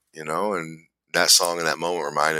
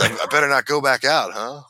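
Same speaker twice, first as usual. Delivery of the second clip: higher, playful imitation of Trey; joking impression; 8 semitones higher than usual. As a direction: tease like he's joking about relapse. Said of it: "he goes".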